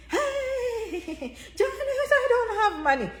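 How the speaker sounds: high laughing mock whine; hammed-up plea through laughter; whining broke viewer. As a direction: play the broke follower, pleading.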